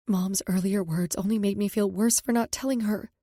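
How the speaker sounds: usual speaking voice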